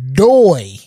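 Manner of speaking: a loud, sarcastic doy; mocking doy. Mocking the police statement with a loud, sarcastic doy. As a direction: rub in how obvious it is.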